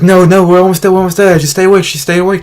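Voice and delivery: urgent mom. Retold or imitated. Mom keeping him awake in car.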